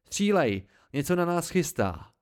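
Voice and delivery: loud, high angry shout; furious yell. A narrator's reading. Narrator furiously orders Martin to shoot, loud and high. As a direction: bark the order in desperate fury. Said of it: "zařval jsem zuřivě".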